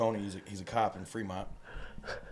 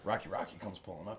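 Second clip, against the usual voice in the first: ordinary talk, nothing marked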